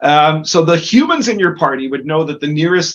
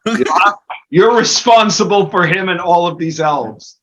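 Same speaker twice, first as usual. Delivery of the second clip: stern headman; 6 semitones higher, 3 dB louder than usual. Acted. Headman warns the wizard.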